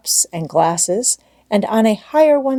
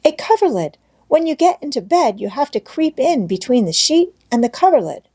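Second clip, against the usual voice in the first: put-on higher, eager child explaining; earnest little girl Heidi; 7 semitones higher than usual. A narrator's reading. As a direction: insist like a busy little girl.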